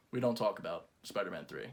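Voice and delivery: low, hushed warning. Acted. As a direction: drop to a solemn near-murmur. Grave hush, quoting line.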